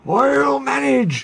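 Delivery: high, slow and near tears; strained cry. A narrator's reading. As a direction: push through with effort. Straining engine, crying.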